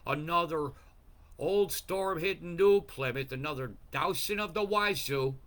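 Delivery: a heated, emphatic weather rant; building rant. Real, not acted. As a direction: ramp it up storm by storm.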